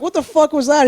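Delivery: high, loud disbelief; stunned at the friend's nerve; shocked outburst. Retold or imitated. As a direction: retell the shock after the walk-away. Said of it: "I was like".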